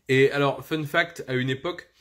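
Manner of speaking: ordinary talk, nothing marked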